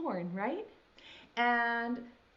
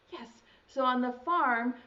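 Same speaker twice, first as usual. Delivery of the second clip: ordinary talk, nothing marked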